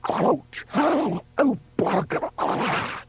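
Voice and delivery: high-pitched cries of labour pain; screaming in pain. A narrator's reading. Woman in labour as her helper arrives, screaming. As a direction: cry out high, as if in agony. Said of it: "a voice screaming".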